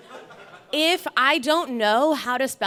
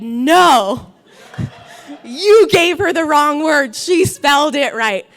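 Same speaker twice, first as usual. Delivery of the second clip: louder, a touch higher protest; defending shout; 4 semitones higher, 9 dB louder, slower than usual. Retold or imitated. Dad from another school shouts to the judge, loud protest. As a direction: stand up and call out for her.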